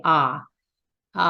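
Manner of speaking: crackly vocal fry on ah; unsupported fry ah; creaky ah. Shown as a demonstration. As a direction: let the ah crackle without breath support. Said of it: "I might sound like this when I say".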